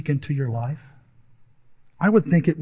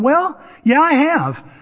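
higher-pitched, slightly drawn-out youngster; eager young reply; 9 semitones higher, slower than usual. Acted. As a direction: answer like a keen eighth grader put on the spot. Acting his hesitant young self.

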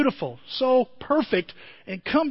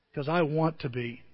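soft divine reply; 5 semitones lower, 6 dB quieter than usual. Retold or imitated. God answering the sinner.

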